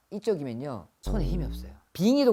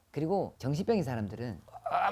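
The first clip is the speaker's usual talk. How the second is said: ordinary delivery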